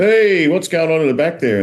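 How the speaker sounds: high, loud cop's challenge; [name]. Acted. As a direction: call out sharply like a policeman catching someone. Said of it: "in your voice".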